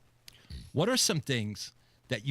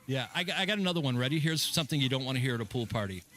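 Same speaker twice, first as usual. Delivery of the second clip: ordinary talk, nothing marked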